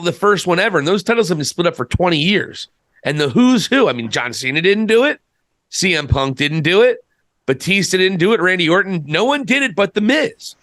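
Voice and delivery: ordinary talk, nothing marked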